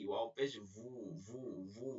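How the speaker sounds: ভু as a long "wuu" from rounded lips. Shown as a demonstration. Teacher drills waw with pesh, drawn rounded wuu. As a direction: round the lips and hold each ভু. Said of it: "ঠোঁট গোল করে".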